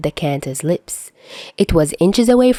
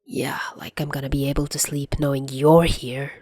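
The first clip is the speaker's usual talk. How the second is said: low breathy grumble under the breath; whispered grumble; grumbled whisper; 3 semitones lower, 6 dB quieter than usual. Acted. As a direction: mutter it grudgingly in a whisper. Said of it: "Chuya grumbled".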